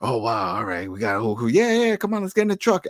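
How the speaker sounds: high-pitched mock enthusiasm; excited group chatter. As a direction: play an eager crew rallying to leave. Acting out doomed group's cheery chatter.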